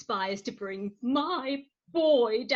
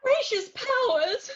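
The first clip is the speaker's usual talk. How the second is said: high shocked outburst; shocked exclamation; 12 semitones higher, 4 dB louder than usual. Acted. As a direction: gasp out in shock. Shock at police.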